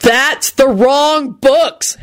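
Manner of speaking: very slow, high exasperated yell. A narrator's reading. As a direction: stretch every word in outrage. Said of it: "Henry yelled".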